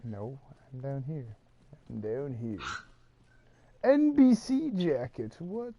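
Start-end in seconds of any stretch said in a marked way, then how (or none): 3.8-5.3 high, slow squeaky voice; high comic squeal; high squeaky exclaim. Pretend. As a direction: squeak it out in surprise. Playful odd exclaim in game chat.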